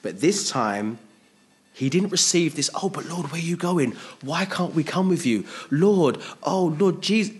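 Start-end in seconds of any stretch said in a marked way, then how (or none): 2.8-7.3 high, loud pleading to the Lord; anxious pleading disciples. Retold or imitated. Preacher voicing disciples begging Jesus, high anxious plea. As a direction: cry out like a worried disciple.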